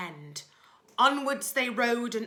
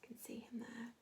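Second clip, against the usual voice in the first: quiet whispered narration; a secretive storyteller's whisper; whispered aside; 13 dB quieter than usual. A narrator's reading. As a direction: lean in and whisper to the children.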